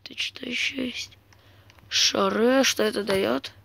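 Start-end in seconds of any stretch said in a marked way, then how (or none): 0.0-1.2 unvoiced whisper to himself. Real, not acted. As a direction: breathe it as a whisper. Whispered aside.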